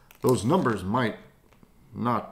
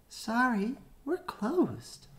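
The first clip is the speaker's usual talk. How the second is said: much higher, piping little voice; high squeaky voice; 12 semitones higher, 4 dB quieter, slower than usual. Acted. Sign character turning customers away, high squeaky voice. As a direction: chirp it out high and small.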